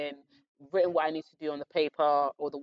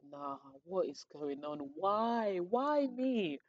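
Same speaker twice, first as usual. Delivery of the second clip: mock despair; 3 semitones higher, 6 dB quieter, much slower than usual. Adding up a bad score at the bus stop, mock despairing wail.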